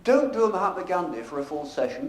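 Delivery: ordinary talk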